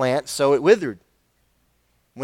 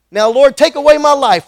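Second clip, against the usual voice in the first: higher, louder plea to die; despairing cry; 8 semitones higher, 9 dB louder than usual. Acted. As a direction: cry out to God, begging. Jonah begs to die.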